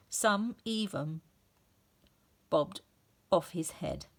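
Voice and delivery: ordinary delivery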